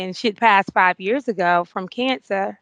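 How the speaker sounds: ordinary delivery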